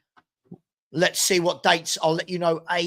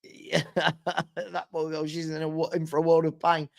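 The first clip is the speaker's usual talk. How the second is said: ordinary delivery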